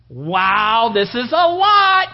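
loud, rising, drawn-out wow; acted amazement. Balaam marvels at all that is offered, amazed.

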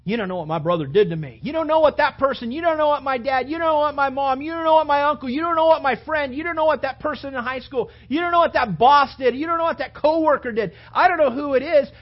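loud, high, whiny protest; mock indignant complaint. Preacher mocks a churchgoer listing people who wronged them, loud and high. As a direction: rant the grievances at full pitch.

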